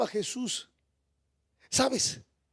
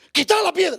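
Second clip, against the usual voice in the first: higher, louder command to roll the stone away; commanding shout; 8 semitones higher, 8 dB louder, faster than usual. Acted. Jesus at the tomb ordering the stone removed, loud commanding call.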